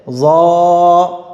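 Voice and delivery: "ज़ा" heavy, rounded and held long; pronunciation demo. Shown as a demonstration. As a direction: hold "ज़ा" full on a level tone. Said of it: "वा में फ़र्क है". ("ज़ा" को मोटा करके खींचें)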